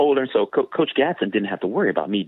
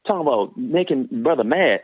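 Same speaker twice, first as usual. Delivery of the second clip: normal talking voice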